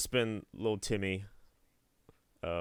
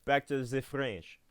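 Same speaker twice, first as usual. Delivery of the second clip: mock French accent, a touch higher; bad French accent; 5 semitones higher, slower than usual. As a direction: put on a hammy French accent. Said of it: "don't think that's a French accent".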